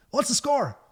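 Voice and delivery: high-pitched imitation of a comedian; Tommy Tiernan. Comedian startling musician at the bar, high imitated voice. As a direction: pipe it up as someone else's voice. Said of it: "I just heard this voice".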